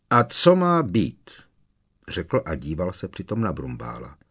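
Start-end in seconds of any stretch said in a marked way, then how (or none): 0.1-1.3 raised, louder defiant retort. A narrator's reading. Minister pushing back at Dumbledore, defiant. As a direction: push back stubbornly, drawing it out. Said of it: "v jeho tváři objevil vzdorný, urputný výraz".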